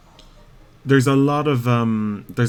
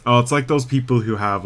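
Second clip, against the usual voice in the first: ordinary delivery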